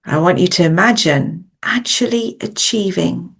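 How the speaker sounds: measured coaching meditation voice with long pauses; guided visualisation. Acted. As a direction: guide them gently inward, phrase by phrase, leaving space to breathe.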